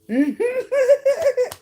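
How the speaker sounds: loud, high burst of cackling laughter; shrieking high laugh; high cackle. Real, not acted. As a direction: cackle at your own joke. Cackling at own joke.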